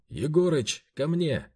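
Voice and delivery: loud call toward the window; shout. A narrator's reading. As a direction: call out loudly for help. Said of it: "крикнул".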